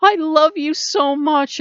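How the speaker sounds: high, loud, adoring dog voice; slow down on melt souls, overflowing with doggy love; a dog smothering its owner with adoration; gushing dog. Retold or imitated. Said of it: "It's not like a dog where".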